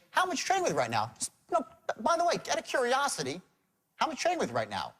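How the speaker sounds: casual, by-the-way question; offhanded. Shown as a demonstration. Sales trainer shows how to ask a prospect casually, offhanded. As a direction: toss it out as idle curiosity. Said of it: "asking the questions in an offhanded manner".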